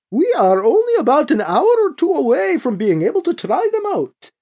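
high, sing-song character voice; excitable Krish character voice. Acted. Krish reporting progress on the drive, high and excitable. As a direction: pitch it high with big swoops.

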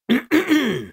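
pointed, high-pitched throat clear. Acted. Cardinal before addressing the new governor, throat clearing. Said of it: "The Cardinal clears his throat".

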